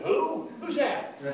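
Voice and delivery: high-pitched, blunt questioning; imitated clueless "who?"; mock puzzled. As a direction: act out a man who has no idea who they mean. Acts out visitor who knows no opener.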